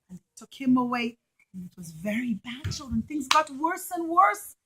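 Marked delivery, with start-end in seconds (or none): none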